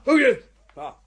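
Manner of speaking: ordinary talk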